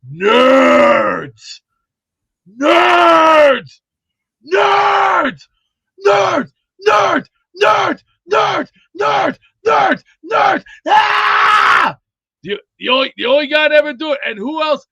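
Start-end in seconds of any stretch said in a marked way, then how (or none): 0.1-12.0 bellowed battle cry, climbing high and loud; Ogre impression. Imitating the guy from Revenge of the Nerds, full-throated bellow. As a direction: roar it out, long cries then rapid repeats. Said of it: "The guy, the guy from Revenge of Nerds when he went".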